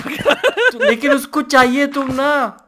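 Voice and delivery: high, laughing tease. Real, not acted. Teasing a friend that the zombie wants him, laughing. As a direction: stretch it out through giggles. Joking about the love-hungry zombie.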